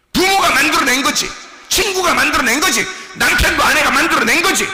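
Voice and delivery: loud, high, hammered repetition; forceful preaching. Real, not acted. Preacher naming who made the false self, forceful repeated proclamation. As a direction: hit each accusation with equal force.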